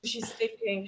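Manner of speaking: usual speaking voice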